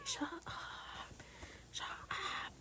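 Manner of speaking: whispered telling-off; hushed shut up. Real, not acted. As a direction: hiss it at the cat. Hushing the cat.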